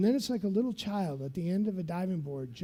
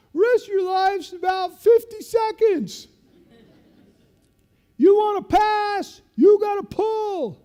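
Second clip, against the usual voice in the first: high, loud, slow laughing shout; laughing taunt; 14 semitones higher, 12 dB louder, slower than usual. Retold or imitated. Sergeant laughing at falling lieutenant, shouting loudly. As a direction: laugh at him while yelling orders. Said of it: "He's laughing".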